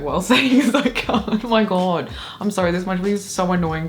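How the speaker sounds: composure cracking into laughter; giggling. Real, not acted. Reader losing it over the goblin confession, giggling. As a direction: burst out laughing mid-read. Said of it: "without laughing".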